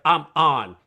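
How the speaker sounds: game-on shout; fired-up declaration. Retold or imitated. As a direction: declare it like a battle cry. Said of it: "I was like".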